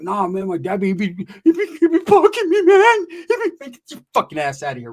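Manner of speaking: high, cracking, half laughing half crying rant; ranting cousin. Retold or imitated. High cousin complaining about his dad, cracking high rant. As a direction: whine it out high and broken.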